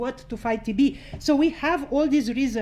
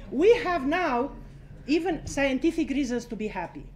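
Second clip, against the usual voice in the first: usual speaking voice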